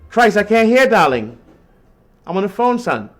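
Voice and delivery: loud call across the room, breaking off the chat; calling out to son. Real, not acted.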